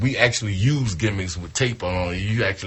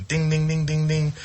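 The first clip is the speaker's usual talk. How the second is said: bell dinging; 7 semitones higher than usual. Pretend. Light bulb going off in head.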